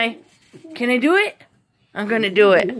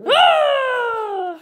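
sudden high yelp; a real startled cry; 11 semitones higher than usual. Real, not acted. Caught off guard during the jump, startled yelp.

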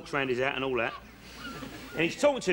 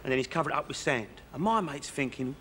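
ordinary delivery

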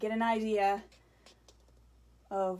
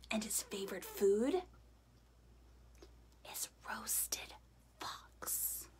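spooky whisper; 8 dB quieter than usual. Acted. Mouse whispering to the fox, hushed and spooky. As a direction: breathe the punchline to frighten.

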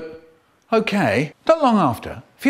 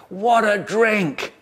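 slow, higher, marvelling; delighted exclamation; 4 semitones higher, slower than usual. A narrator's reading. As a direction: relish the words slowly. Delight at the drink.

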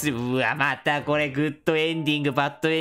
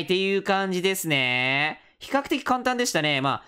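usual speaking voice

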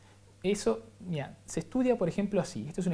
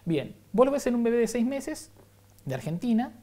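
usual speaking voice

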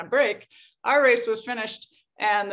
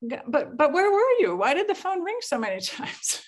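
puzzled insistence; 8 semitones higher than usual. Retold or imitated. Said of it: "I was like".

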